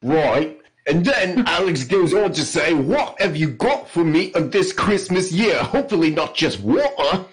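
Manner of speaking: hammed-up jolly-good British accent; mock English accent. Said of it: "his best English accent".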